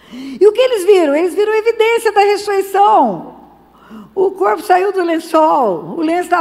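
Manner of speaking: loud, high preaching burst; rising preaching. Real, not acted. Preacher crying out to the congregation, loud and high. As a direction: lift the church with it.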